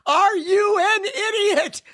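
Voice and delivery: high, slow, stretched-out yell of disbelief; shouting at driver. Retold or imitated. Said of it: "I go".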